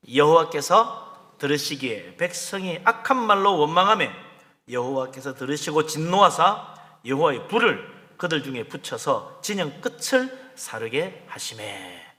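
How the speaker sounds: slow, emphatic Bible verse reading; scripture reading. Acted. Preacher reads the verse to the congregation, solemn declamation. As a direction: read the verse out in measured, forceful phrases.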